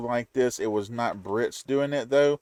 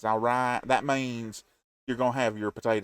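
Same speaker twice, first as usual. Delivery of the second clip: ordinary talk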